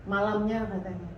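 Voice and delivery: ordinary talk, nothing marked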